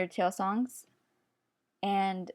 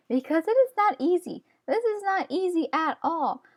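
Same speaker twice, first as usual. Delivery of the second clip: exasperated; 8 semitones higher than usual. Real, not acted. Frustrated at non-dominant hand.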